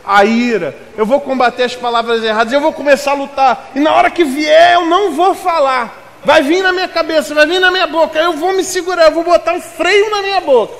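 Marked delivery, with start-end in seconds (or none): 2.5-10.7 building from firm to high, loud preaching; preaching at full force. Acted. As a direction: rise into a fervent pulpit cry.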